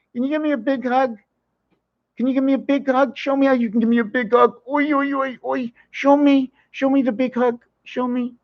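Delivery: put-on high, sing-song baby talk to a cat; pet talk. Talking to the cat in high cooing baby talk. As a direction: coo over the cat like a baby.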